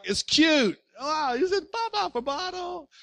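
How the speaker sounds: gentle mock baby-talk praise; cooing parent. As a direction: admire the baby's first word. Parent praising baby's word.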